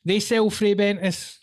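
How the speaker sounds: ordinary talk, nothing marked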